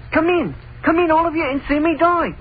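high-pitched imitation of a yell; retold yell. Witness telling the questioner what was yelled, imitating it. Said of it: "He yelled".

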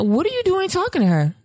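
jealous accusing. Retold or imitated. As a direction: pitch it up and accuse him. Jealous wife quarrel retold.

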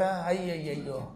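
soft, slow retold wail of grief; a quiet, drawn-out cry of mourning for the lost son; mock lament. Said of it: "ఏడ్చారట".

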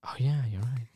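whispered, voiceless concession; hushed aside. Real, not acted. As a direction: drop to a whisper, as if caught out.

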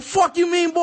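high-pitched retold challenge; defiant comeback. Dunking player talks back to the monitor, defiant.

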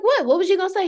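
prodding surprise. Retold or imitated. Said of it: "I'm like".

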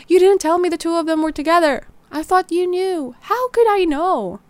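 frantic argument. Acted. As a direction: snap at her in panic. Panicked argument with Jay.

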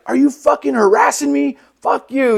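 high-pitched imitation of an outraged driver; mock angry woman. Road-rage woman telling him off, high mock outrage.

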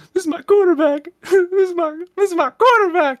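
high, loud, cracking mock T.O. wail; T.O. impression. Host imitates T.O.'s famous tearful defense of his quarterback. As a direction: ham up T.O.'s tearful "my quarterback" cry. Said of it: "the old T O fucking, "This is my quarterback".

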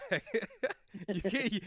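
ordinary talk, nothing marked

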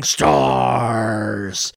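long, drawn-out monster groan, falling away; Nemesis. Retold or imitated. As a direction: drag the one word out like a lumbering monster. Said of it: "just kinda wandering around going".

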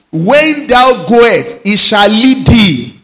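proclaimed scripture. Acted. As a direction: stretch each word out in holy awe. Reads verse twenty-two.

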